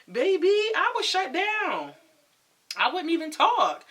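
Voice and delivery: loud, high-flying dramatic emphasis; emphatic exclamation. Retold or imitated. Emphatic retelling of shutting down.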